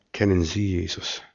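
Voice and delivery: quiet and undramatic; calm, unexcited question. Retold or imitated. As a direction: ask it quietly, without any drama. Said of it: "gar nicht so aufgeregt".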